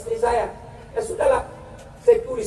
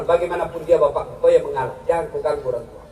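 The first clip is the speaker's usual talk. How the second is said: solemn divine voice; 8 semitones lower than usual. Retold or imitated. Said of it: "Allah berfirman".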